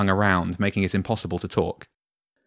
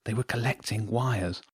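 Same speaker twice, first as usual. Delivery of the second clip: hushed, softer voice; whispering; 7 dB quieter than usual. A narrator's reading. Jenny telling James about the rats, whispering. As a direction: confide it under your breath. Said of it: "Jenny whispered".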